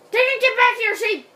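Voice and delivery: high, loud shout; loud high-pitched exasperation; frustrated yell. Real, not acted. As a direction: yell after a runaway animal. Yells after fleeing sheep.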